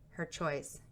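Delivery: usual speaking voice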